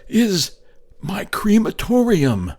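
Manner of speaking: high, loud cry; sudden cry. A narrator's reading. The narrator finds the derelict boat and cries out. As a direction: shout it out, suddenly inspired. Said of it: "said I with a sudden cry".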